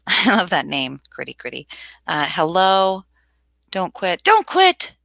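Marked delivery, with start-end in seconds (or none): 4.2-4.9 much higher and louder, urging hard; emphatic cheer. Real, not acted. Streamer urges a dissertation student not to give up, loud cheer. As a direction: shout it as a rallying cry.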